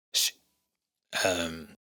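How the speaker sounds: ordinary talk, nothing marked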